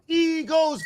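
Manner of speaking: ordinary talk, nothing marked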